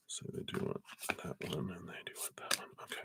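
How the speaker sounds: whispering to herself over the cards; hushed whisper while laying out cards. Real, not acted. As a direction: breathe the words out with no voice.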